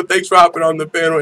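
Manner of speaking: normal talking voice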